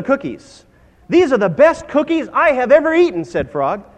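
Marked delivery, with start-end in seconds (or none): 1.1-3.2 high, loud storybook enthusiasm; gushing praise, pitched up; delighted exclamation. Acted. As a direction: rave about it as a delighted Frog. Frog acted, delighted by cookies.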